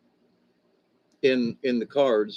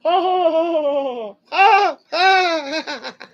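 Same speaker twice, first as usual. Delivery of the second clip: big laugh; 7 dB louder than usual. Real, not acted. Laughing at own joke setup.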